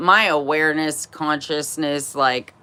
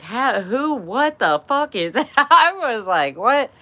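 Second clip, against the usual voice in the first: high, baffled and laughing; sputtering, half-laughing confusion; laughing shock; 8 semitones higher than usual. Retold or imitated. Seeing drunk mom suddenly alert, laughing.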